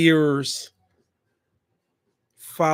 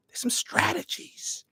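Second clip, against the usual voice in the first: whisper; 12 semitones higher, 9 dB quieter than usual. Pretend. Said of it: "whispered aside".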